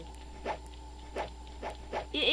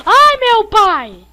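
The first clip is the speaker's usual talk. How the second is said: loud, slow, high panicked wail; panicked cry; 9 semitones higher, 23 dB louder, much slower than usual. Real, not acted. Killer chasing him.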